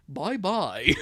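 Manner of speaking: put-on dropped boyfriend voice for a goodbye; Bobby. Boyfriend saying goodbye to the siblings, lightly voiced. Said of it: "That's Bobby's voice".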